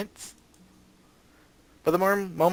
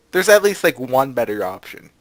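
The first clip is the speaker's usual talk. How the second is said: usual speaking voice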